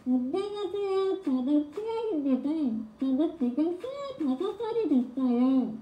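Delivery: high voice through covered mouth; muffled mouth voice. Shown as a demonstration. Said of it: "좀 잘 됐죠, 방금?".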